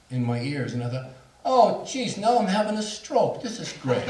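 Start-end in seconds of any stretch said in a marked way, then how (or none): 1.4-4.0 high, loud "oh great" exasperation; pitched-up, comic self-pitying outburst; mock exasperated alarm. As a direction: exclaim in mock dismay, settling into sarcasm. Acted alarmed thought to self.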